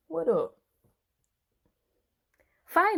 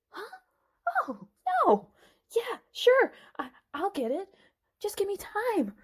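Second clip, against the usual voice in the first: frightened; 11 semitones higher than usual. Acted. Threatened with fire.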